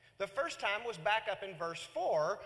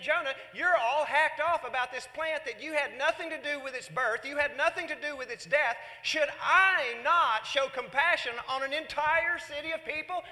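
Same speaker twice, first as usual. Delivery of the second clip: louder, higher, half-laughing rebuke; God scolding Jonah over his plant, loud; preaching God's rebuke; 6 semitones higher, 7 dB louder than usual. Retold or imitated. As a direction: boom out God's question with a chuckle. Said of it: "He's saying".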